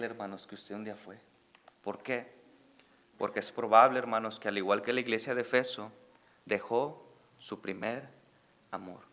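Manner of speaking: low, soft and sincere appeal; soft reflective. Acted. Pastor quietly urging listeners to examine their lives. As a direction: speak it gently, inviting reflection.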